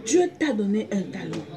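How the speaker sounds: ordinary talk, nothing marked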